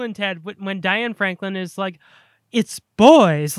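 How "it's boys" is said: mock British accent, loud. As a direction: imitate an actress's British accent, punched out. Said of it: "like, her British accent".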